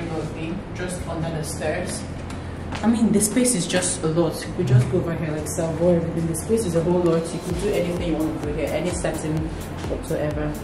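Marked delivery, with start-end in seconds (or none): none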